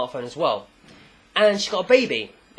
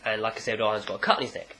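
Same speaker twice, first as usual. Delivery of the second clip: ordinary delivery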